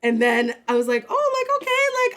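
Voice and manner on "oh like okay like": very high, sustained mock realization; retold delighted surprise, pitched way up; pleasant surprise. Retells delight at found money.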